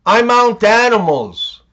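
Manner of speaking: usual speaking voice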